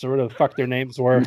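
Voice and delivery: normal talking voice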